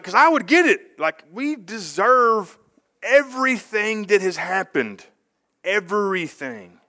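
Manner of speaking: lamenting confession. Retold or imitated. Jeremiah confessing to God, slow and lamenting. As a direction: confess slowly that we deserve it all. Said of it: "He's like".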